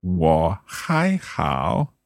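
slow, careful Mandarin 我还好 with each tone placed; careful Chinese. Shown as a demonstration. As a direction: spell out 我还好 slowly like a learner. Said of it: "learner repeats Chinese phrase".

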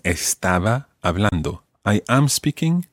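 ordinary talk

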